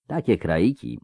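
lightly voiced drawler; drawled syllables. A narrator's reading. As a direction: stretch out each syllable. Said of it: "powiedział przeciągając sylaby".

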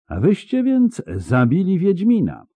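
high-pitched insinuating repeat; high, mocking disbelief; nasty smirk. A narrator's reading. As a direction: echo it through an ugly smile. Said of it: "powtórzył, uśmiechając się nieładnie".

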